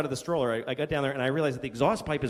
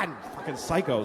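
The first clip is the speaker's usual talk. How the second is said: ordinary talk, nothing marked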